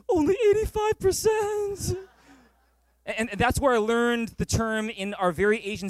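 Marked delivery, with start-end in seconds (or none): 0.1-2.0 high, slow whiny wail; mock despairing teen.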